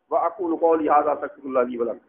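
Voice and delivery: low, rapid Arabic sermon formula; khutba recitation. Acted. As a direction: recite the Friday sermon formula in one breath.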